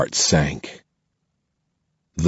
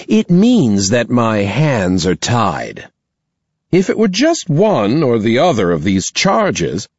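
higher, slow and disapproving; a headmistress weighing her words; stern principal voice; 5 semitones higher than usual. Acted. Principal delivers expulsion.

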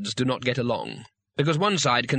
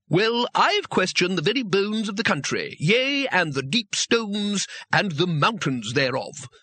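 high, slow, archaic pompous proclamation; grandiose seer; 7 semitones higher, slower than usual. A narrator's reading. Ganmak boasting grandly to the council, slow pompous declamation. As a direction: declaim it like scripture.

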